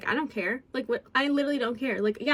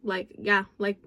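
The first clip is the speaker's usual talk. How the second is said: ordinary delivery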